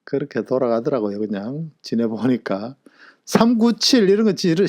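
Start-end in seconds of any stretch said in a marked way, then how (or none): 3.2-4.7 mock disgust. Mock disgust at messy numbers.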